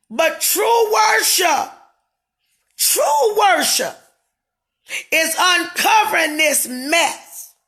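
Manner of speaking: high and loud, drawn out slowly; preaching shout. Real, not acted. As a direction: hammer the words like a preacher. Ranting on Facebook live.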